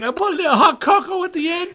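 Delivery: very high, loud, gleeful mimicry; imitated excitement. Friend mimicking homeless guy adding cocoa, over-the-top excited. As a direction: send it way up, laughing with delight. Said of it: "so excited about it".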